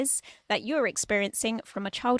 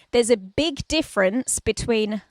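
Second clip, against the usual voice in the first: ordinary delivery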